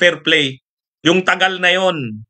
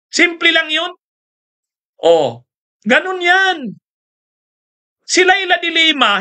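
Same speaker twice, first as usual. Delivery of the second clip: heated outburst; 10 semitones higher than usual. Real, not acted. Heated rant about fleeing accused.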